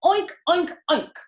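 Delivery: high loud oink oink. A narrator's reading. Avery in the crate oinking loudly. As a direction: grunt like a pig.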